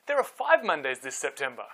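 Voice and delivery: ordinary delivery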